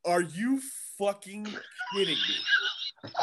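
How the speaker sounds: slow, high, laughing through the words; stunned comic laughing at the border booth; laughing disbelief. Retold or imitated. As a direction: let the laugh break in as the prank sinks in. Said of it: "And I was like".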